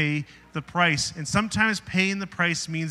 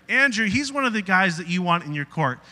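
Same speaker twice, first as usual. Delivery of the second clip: ordinary talk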